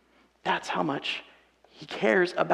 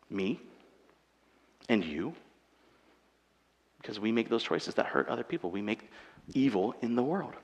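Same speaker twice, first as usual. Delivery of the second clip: much lower and softer, sombre; hushed confession; 8 semitones lower, 9 dB quieter than usual. Real, not acted. Preacher admitting we make evil, hushed and grave. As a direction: drop to a hush for the hard truth.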